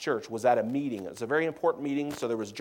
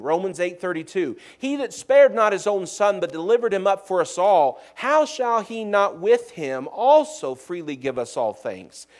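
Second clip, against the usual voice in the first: raised, louder pulpit reading voice; scripture reading; 7 semitones higher, 7 dB louder than usual. Acted. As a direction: proclaim the verses to the congregation, ringing out. Reads scripture at full preaching force.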